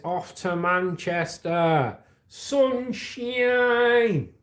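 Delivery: hammed-up Manchester accent, drawn out and high. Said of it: "That's my Manchester accent".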